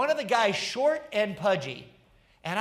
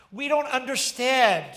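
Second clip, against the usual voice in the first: crowd crying out; 4 semitones higher, 7 dB louder than usual. Acted. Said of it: "And the people cried out".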